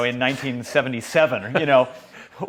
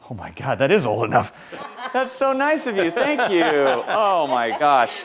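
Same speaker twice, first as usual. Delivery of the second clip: high, delighted laughing thanks; laughing, touched; 7 semitones higher, 5 dB louder, slower than usual. Real, not acted. Thanking the singers with delighted laughter.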